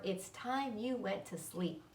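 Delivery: soothing. Acted. Charlotte settling Wilbur down.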